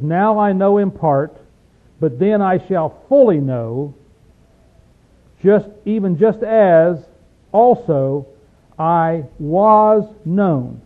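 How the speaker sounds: slow, forceful verse reading; scripture reading. Acted. Preacher reading a verse to his class, slow and emphatic. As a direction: read it out weightily, drawing out key words.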